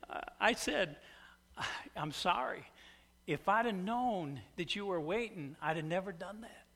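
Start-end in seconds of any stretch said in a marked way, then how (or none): 2.0-6.7 much softer, gentle apology. Retold or imitated. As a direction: apologize meekly and quietly. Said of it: "it comes out of my mouth".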